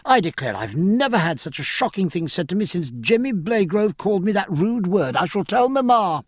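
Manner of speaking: put-on high, quick, indignant girl; a spoiled young lady taking offence; Cecilia. Narrator voices girlish Cecilia.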